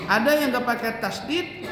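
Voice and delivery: ordinary talk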